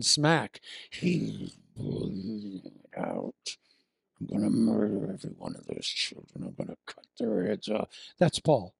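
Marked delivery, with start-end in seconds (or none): 0.9-3.6 deep, slow, breathy exhale; heavy mock breathing. Preacher mocking Saul's threats, heavy menacing breath. 4.2-7.9 low, soft menacing villain voice; hammed-up murderous threat; mock villain Saul. Preacher voicing Saul planning murder, soft mock menace.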